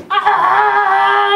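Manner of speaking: high, loud excited yelp; excited cry. Real, not acted. Overexcited unboxer yelping with joy. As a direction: let the excitement burst out.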